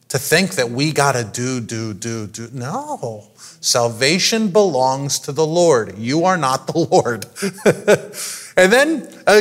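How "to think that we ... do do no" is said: mock busy do do do sinks to a hush; fading to near silence. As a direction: let the repeated do die away, then a soft no. Mocking frantic doing, then no.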